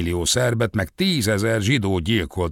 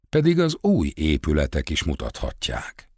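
ordinary delivery

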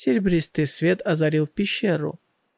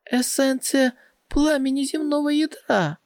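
high excited outcry; excited cry; 9 semitones higher than usual. Acted. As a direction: cry out in amazed discovery. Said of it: "и она снова крикнула".

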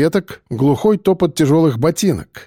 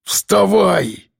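higher, forceful command; angry bark; 5 semitones higher than usual. A narrator's reading. Biker orders fallen woman up, angry bark. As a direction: bark at her to get up. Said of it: "рявкнул ворон".

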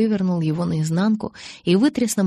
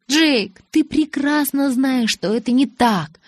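high, exasperated plea; exasperated protest; 7 semitones higher, 3 dB louder, slower than usual. A narrator's reading. Bella groans at hurt Jacob's accusation, exasperated protest. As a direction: insist on it, pitch raised and stretched.

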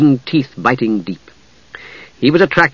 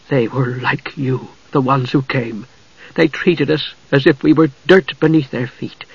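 trembling anger. A narrator's reading. Usha accusing the knight of invasion, restrained trembling anger. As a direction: hold the anger back as it builds. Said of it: "Her voice trembled in anger".

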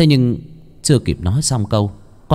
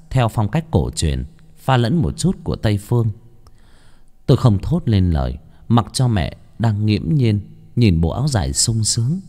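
ordinary delivery, nothing marked

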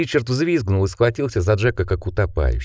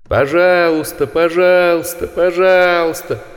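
higher, louder, slow inhuman chant; inhuman chorus; 8 semitones higher, 4 dB louder, slower than usual. A narrator's reading. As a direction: drag it out like a monstrous crowd. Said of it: "хор нечеловеческих голосов".

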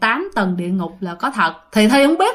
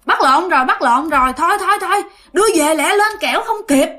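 higher, hurried panic at a mistake; alarmed cry; 6 semitones higher than usual. Retold or imitated. Underworld guard realizing they took the wrong woman, alarmed. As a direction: blurt it out as if caught in a blunder. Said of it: "Thì một người mới nói".

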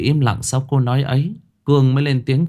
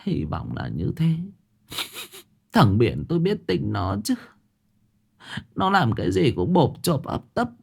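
higher, softer tearful mother; teary; 6 semitones higher, 4 dB quieter than usual. Acted. Mother, tearful, tells the guests her son means no harm. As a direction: defend your son through tears. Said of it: "Bà Bộc rơm rớm nước mắt".

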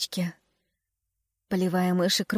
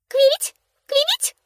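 very high, loud chirp; bird chirp; 4 dB louder than usual. A narrator's reading. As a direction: chirp it like a swallow. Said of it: "прочирикала птичка".

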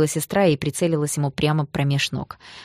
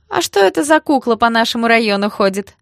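loud, higher-pitched taunting question; nasty smirk; 6 semitones higher, 6 dB louder than usual. A narrator's reading. Thug mocks the boy in his district, smirking. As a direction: sneer it with a nasty grin. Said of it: "с нехорошей ухмылкой спросил один".

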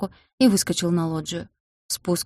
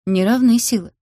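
smirking; 5 semitones higher, 6 dB louder than usual. A narrator's reading. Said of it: "усмехнулся Тимир".

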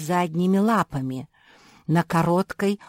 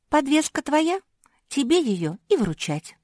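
high, louder brusque retort; cutting refusal; 10 semitones higher, 4 dB louder than usual. A narrator's reading. Pug refusing Ninon's errand, high and curt. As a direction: cut her off, pitched up. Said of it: "отрезала мопсиха".

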